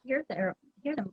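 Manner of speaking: ordinary delivery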